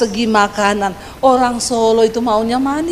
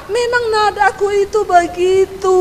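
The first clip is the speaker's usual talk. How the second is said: exasperated self-defence; 7 semitones higher than usual. Retold or imitated. Wife defends her natural tone to husband, high and exasperated. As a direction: insist with pitch held high.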